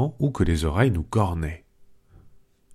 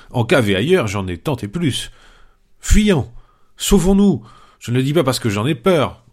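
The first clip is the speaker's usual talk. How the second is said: panicked outcry; 4 semitones higher than usual. Acted. Panurge to his companions hearing voices, panicking. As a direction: yelp it out like a man sure he's about to die. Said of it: "Panurge s'écria".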